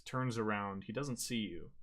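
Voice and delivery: ordinary delivery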